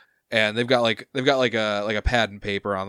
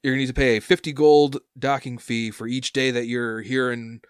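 higher, louder chirpy worker; eager dock worker; 4 semitones higher, 4 dB louder than usual. Acted. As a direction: bounce up and explain fees eagerly.

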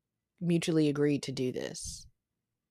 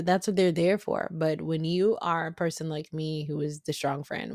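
ordinary talk, nothing marked

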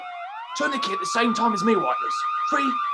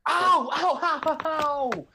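pained ow cries; 4 dB louder, much slower than usual. Acted. Kicked by the woman.

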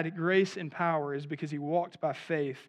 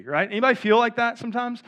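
normal talking voice

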